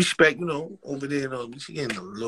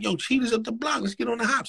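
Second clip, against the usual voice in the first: pitched-up eager suggestion; raised excited voice; 10 semitones higher than usual. Retold or imitated. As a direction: lift it high and eager. Friend suggests going to Cheetah's.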